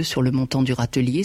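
ordinary delivery

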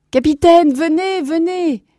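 high, drawn-out excited call. Acted. Grandson beckoning the captain frantically, excited calling. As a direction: call them over, high and urgent. Boy calls captain over.

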